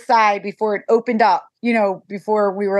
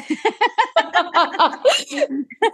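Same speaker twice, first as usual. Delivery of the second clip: high teary laugh; 8 semitones higher than usual. Real, not acted. Laughs, moved to tears.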